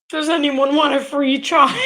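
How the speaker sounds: laughing. Real, not acted. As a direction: toss the line out half laughing. Joking offer while laughing.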